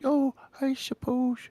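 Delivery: put-on high, slow posh old man. Shown as a demonstration. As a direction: lift it up into a fussy old gent. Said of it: "Then you go a little".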